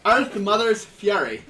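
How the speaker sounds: loud Irish accent, drawn out slowly. Shown as a demonstration. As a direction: proclaim the title in an Irish accent. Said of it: "my attempt at an Irish accent".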